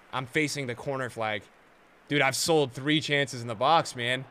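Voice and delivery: ordinary delivery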